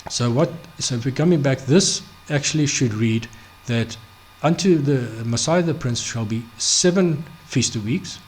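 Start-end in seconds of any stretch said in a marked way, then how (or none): none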